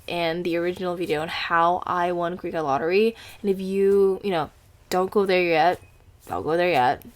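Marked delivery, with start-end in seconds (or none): none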